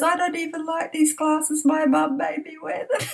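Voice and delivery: high, giggling voice for the daughter's fib; laughing retold excuse; laughing through quote. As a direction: quote her while cracking up.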